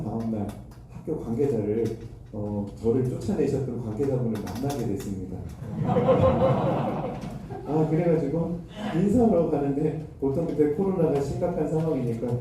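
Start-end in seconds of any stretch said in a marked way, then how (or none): 5.4-7.6 breathy real laugh, voice high. Real, not acted. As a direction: laugh aloud at your own story. Laughs recalling meeting the man. (자기 이야기에 터지는 웃음)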